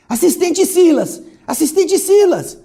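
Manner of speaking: high, loud urgent call; anxious exclamation. A narrator's reading. Anxious woman blocking Silas's path, crying out. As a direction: call out desperately to stop him. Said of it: "exclamando ansiosa".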